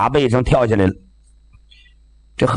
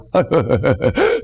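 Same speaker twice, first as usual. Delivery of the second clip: nervous fawning laugh; 2 semitones lower than usual. Acted.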